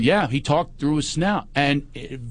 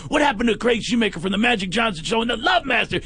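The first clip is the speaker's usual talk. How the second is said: mock psychic; 8 semitones higher than usual. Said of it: "He goes".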